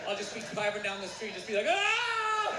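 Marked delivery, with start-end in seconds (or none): 1.7-2.5 cringing yell. Retold or imitated. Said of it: "shout the memory out of my head".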